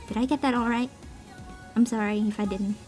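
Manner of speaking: normal talking voice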